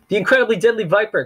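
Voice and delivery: high, loud faked alarm; boy faking panic to fool the adults; cried out. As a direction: pretend to be terrified of the snake. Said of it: "Klaus cried".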